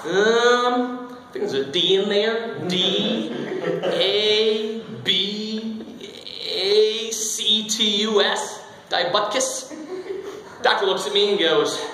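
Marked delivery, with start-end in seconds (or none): 0.1-9.7 put-on seven-year-old spelling it out. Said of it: "best a seven-year-old could".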